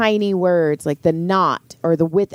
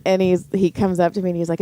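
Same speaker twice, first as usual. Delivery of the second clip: normal talking voice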